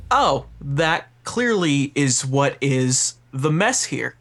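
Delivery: ordinary talk, nothing marked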